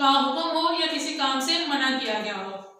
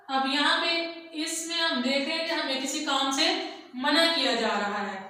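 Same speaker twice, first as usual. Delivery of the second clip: ordinary talk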